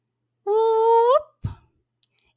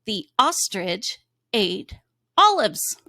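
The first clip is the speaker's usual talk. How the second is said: slow read-aloud; slower than usual. Shown as a demonstration. Teacher reading the sentence slowly first. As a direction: read each word slowly for kids to follow. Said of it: "I'll read it the first time".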